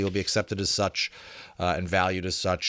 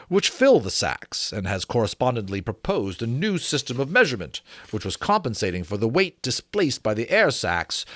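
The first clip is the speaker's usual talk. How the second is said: period treatise read a touch higher and fuller; formal reading; 3 semitones higher, 3 dB louder than usual. A narrator's reading. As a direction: read it like a learned 1790s author. Resumes the treatise.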